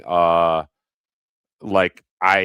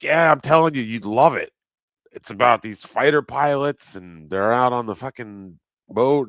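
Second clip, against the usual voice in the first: high, louder enthusing; eager pitch; 5 semitones higher, 5 dB louder than usual. Retold or imitated. Telling the cashier she'd love it, eager. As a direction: oversell it to a stranger. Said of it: "So I'm like".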